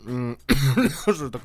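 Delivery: coughing, saliva down wrong pipe; choking cough. Real, not acted. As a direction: choke and cough mid-sentence. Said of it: "Слюна попала, не в то горло". (поперхнулся, кашляет)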